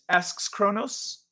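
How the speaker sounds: normal talking voice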